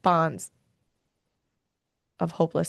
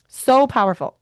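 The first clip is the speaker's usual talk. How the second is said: higher and louder burst; a sudden loud, high echo; emphatic repeat; 6 semitones higher, 7 dB louder than usual. Real, not acted. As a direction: blurt it out, full of feeling.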